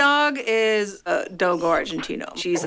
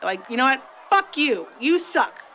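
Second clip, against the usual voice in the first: angry outburst; 5 semitones higher than usual. Retold or imitated. Fighter yelling at her coach, angry and high. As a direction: blow up at him, cursing. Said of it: "And I'm like".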